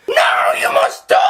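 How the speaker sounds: very high, loud, slow alien screech; menacing alien shriek. Acted. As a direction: threaten them as a monstrous creature. Nestene threatening.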